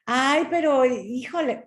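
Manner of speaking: high mock exasperation; exasperated protest. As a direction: imitate a friend protesting, starting high and loud. Friend exasperated by repeated answer.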